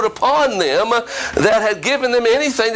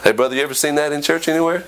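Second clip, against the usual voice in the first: usual speaking voice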